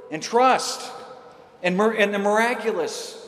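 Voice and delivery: high, fervent preaching build; impassioned sermon delivery; preaching at full force. Acted. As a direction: preach it out with rising conviction.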